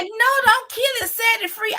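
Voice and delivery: high, insistent plea; lightly acted pleading coworker.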